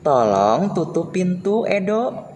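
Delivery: teacher showing a polite request, friendly. Shown as a demonstration. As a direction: ask kindly, modelling good manners. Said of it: "berikut contoh kalimat perintah".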